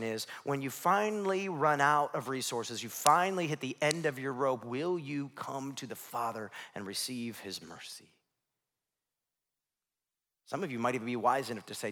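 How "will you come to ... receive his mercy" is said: normal talking voice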